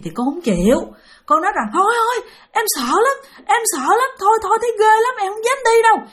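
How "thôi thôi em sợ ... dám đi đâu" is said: high, frightened refusal; scared pleading. Retold or imitated. Terrified little sister begs her brother not to follow: high, scared pleading. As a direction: beg him not to make you go.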